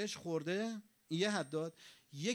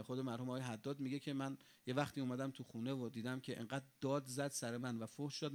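usual speaking voice

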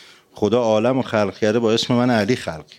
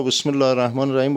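quick, higher-pitched بسم الله الرحمن الرحیم with Azeri qaf and gaf; Azeri accent; 3 semitones higher, faster than usual. Retold or imitated. Azerbaijani man reciting Fatiha over the sick sheikh, Azeri accent. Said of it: "با اون لهجهٔ آذریش".

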